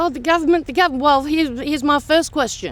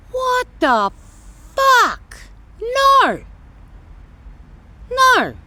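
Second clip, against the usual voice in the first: outraged disbelief; 11 semitones higher, much slower than usual. Real, not acted. Ranting at Facebook posters, outraged shock. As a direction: recoil in shock, then refuse flatly.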